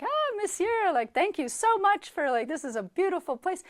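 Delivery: high, loud, effusive thanks; gushing greeting. Retold or imitated. Woman thanks a senator on the stairs, gushing. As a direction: imitate a starstruck visitor gushing.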